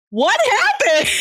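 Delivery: high, slow, stunned question; drawn out in pure confusion; baffled. Retold or imitated. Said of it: "And you're just like".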